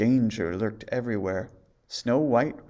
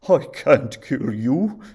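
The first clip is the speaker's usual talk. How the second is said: loud, slow, broken plea; huntsman's tearful confession; sobbing; 2 semitones higher, 9 dB louder, slower than usual. A narrator's reading. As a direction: cry out in guilt as the huntsman. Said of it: "he sobbed".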